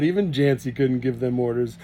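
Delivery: usual speaking voice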